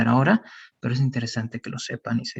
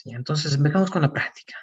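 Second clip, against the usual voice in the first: whisper; faster than usual. Real, not acted. Teacher whispers aside.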